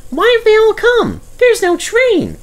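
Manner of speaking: high, eager little engine voice; Percy's voice. Acted. Percy reminding Douglas, in a high eager voice. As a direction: play the small cheeky tank engine.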